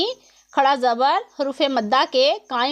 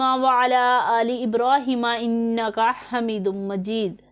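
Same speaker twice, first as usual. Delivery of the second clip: Quranic recitation. Acted. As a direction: recite the prayer in measured melodic Arabic. Opening invocation and durood recited.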